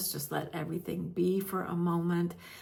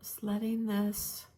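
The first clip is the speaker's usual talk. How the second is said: guided meditation. Real, not acted. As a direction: gently guide listeners into stillness, leaving space between phrases.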